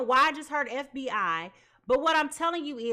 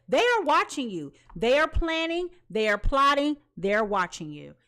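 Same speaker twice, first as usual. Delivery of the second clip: louder, insistent warning; urgent warning; 2 semitones higher, 6 dB louder than usual. Real, not acted. Reader warns client they are watched, loud and urgent. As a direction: press each line harder, alarmed.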